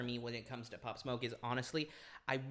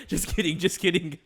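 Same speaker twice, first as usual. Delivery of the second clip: laughing; 5 semitones higher, 11 dB louder than usual. Real, not acted. Laughing off a joke.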